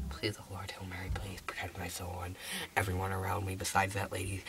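very low, whispery mock prayer; hushed praying. Hider begging to stay unfound, hushed prayer. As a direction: pray under your breath so nobody hears.

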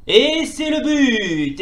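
drawn-out commentator goal cry; long stadium-style goal shout; goal call. Acted.

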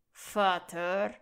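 a loud, sharp snapped rebuke; hissed rebuke. Acted. Son snaps at his cruel father, loud snarl. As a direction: cut him off with one angry word. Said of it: "fauchte Draco".

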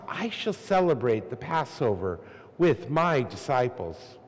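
usual speaking voice

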